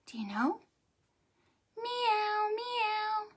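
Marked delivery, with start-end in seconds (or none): 1.8-3.3 high, drawn-out kitty meows; cat meow. Shown as a demonstration. Storyteller showing children a kitty cat's sound, high meowing. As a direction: mew like a little cat. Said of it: "What sound does a kitty cat make?".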